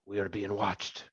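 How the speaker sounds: soft, guarded aside; quiet in-character caution; Cannon's hushed warning. Acted. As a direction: warn a companion under your breath. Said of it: "Cannon kinda stops and turns to Boudica".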